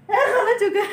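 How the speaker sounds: laughing through the words; giggling mid-sentence. Real, not acted. Laughing while eating.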